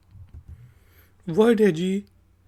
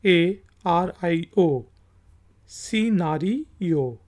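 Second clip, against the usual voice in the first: slow spelling; much slower than usual. Shown as a demonstration. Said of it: "word है जी".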